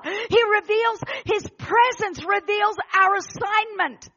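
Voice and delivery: loud, driving sermon delivery; full-force preaching. Real, not acted. Preacher urging a congregation into God's glory, impassioned. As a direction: proclaim it, drawing out the line endings.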